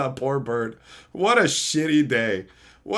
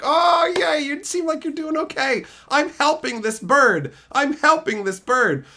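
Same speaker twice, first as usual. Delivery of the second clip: put-on proud child voice; 12 semitones higher than usual. Voicing proud child self.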